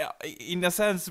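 usual speaking voice

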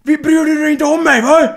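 high, loud pleading rant; calling out to her sister behind the door; mock yell through a door. Sister shouting through a closed door, mock plea.

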